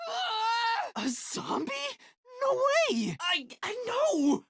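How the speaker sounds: high panicked shriek; terrified scream. Acted. Spotting a zombie, shrieking in terror. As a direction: scream in horror.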